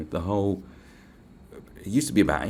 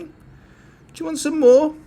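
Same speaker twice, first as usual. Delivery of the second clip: slow, much higher, louder silly voice; silly high offer of more data; odd put-on voice; 10 dB louder, much slower than usual. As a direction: tease the audience in a strange high voice. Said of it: "Sorry, I don't know I'm speaking like that".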